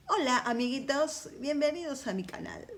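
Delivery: put-on high sing-song kid youtuber greeting; bright squeaky sing-song welcome. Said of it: "Como dicen los nenes cuando intentan entrar a este universo".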